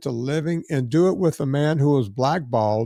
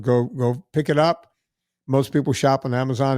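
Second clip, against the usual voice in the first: ordinary talk, nothing marked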